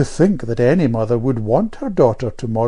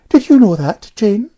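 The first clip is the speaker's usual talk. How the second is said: put-on high, louder piping girl; piping know-all child; 10 semitones higher, 9 dB louder than usual. A narrator's reading. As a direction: pipe up like a little know-all. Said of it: "piped Amelia".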